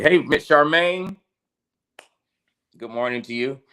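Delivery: ordinary talk, nothing marked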